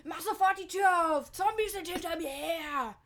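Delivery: high, loud panicked shout; panicked yell. Acted. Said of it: "Brian schrie".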